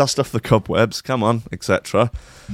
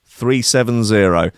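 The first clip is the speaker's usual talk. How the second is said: slow, spaced-out number reading, announcer voice; reading out faulty batch codes like lottery numbers, slow and grand; lottery number call; 2 semitones higher, 4 dB louder, slower than usual. Pretend. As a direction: call each digit like a lottery ball. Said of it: "like reading out lottery numbers".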